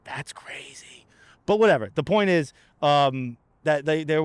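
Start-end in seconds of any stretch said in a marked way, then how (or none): 0.1-1.1 slow, breathy whisper; whispered aside. Real, not acted. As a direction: drop to a conspiratorial whisper. Whispered aside about ICE budget.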